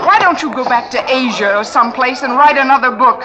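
furious public quarrel, voice climbing; angry outburst. Acted. Quarrel in a cocktail lounge.

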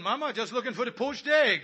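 usual speaking voice

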